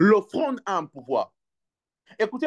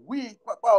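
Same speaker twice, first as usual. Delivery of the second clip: normal talking voice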